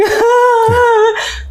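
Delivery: high, drawn-out mock wail; hammed-up bawling; imitated crying.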